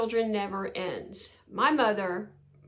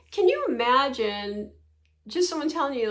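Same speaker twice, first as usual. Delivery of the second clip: normal talking voice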